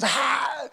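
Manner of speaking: high laughing wail; laughing to tears. Shown as a demonstration. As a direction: act laughing so hard it turns to crying. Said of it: "Laugh hard".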